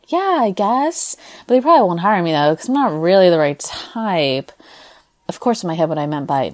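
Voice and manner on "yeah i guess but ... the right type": doubtful shrug. Retold or imitated. Actress shrugging off friends' praise, then rushing doubt. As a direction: brush off the compliment, then rush the doubt. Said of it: "I would say something like".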